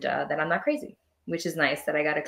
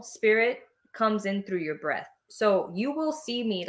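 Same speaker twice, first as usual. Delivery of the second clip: normal talking voice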